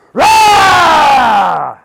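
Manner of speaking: high, very loud long roar; dragon roar. Acted. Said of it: "the dragon goes".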